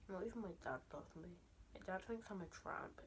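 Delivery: usual speaking voice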